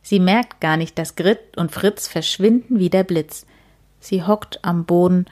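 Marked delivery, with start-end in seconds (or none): none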